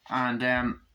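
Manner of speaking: usual speaking voice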